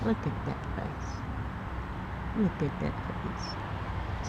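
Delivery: unvoiced cooing whisper to a pet. Real, not acted. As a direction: breathe it softly, adoring. Cooing at the snake.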